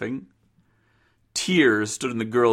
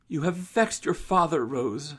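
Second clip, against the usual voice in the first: higher-pitched mother's voice; reproving mother; 6 semitones higher, 3 dB quieter than usual. Acted. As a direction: gently reprove in a raised pitch.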